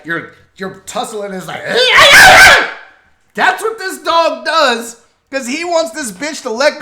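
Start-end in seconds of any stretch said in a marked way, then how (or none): 1.7-2.8 loud high burst of laughter. Real, not acted. Loud laugh at the dog tussling. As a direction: crack up out loud.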